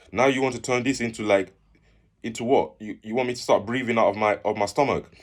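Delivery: mock-outraged, pushing back; indignant retort. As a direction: retell an exasperated protest at the doctor.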